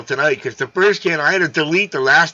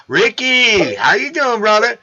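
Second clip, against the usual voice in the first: higher, louder hearty hello; excited greeting; 6 semitones higher, 4 dB louder, slower than usual. Real, not acted. Host welcoming Ricky onto the stream, loud and upbeat. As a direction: call out to a buddy you're glad to see.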